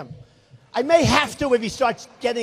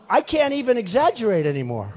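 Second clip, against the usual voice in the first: ordinary talk, nothing marked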